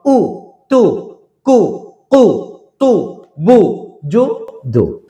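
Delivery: উ, তু, কু as firm separate syllables with rounded lips. Shown as a demonstration. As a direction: round the lips and hit each উ hard. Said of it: "পেশ দিয়ে ঠোঁটগুলো হবে". (ঠোঁট গোল করে শক্ত উচ্চারণ)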